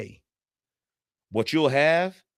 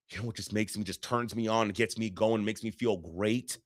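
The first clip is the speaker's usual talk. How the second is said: mock sultry wife; 4 semitones lower, 8 dB quieter than usual. Coach parodying a wife who loves neglect, soft mock purr. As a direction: tease it like a parody of desire. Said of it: "Said no woman ever".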